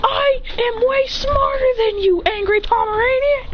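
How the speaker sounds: high, stretched strained cry; acted angry taunt. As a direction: hurl the insult, high and drawn out. Acted insult in quarrel.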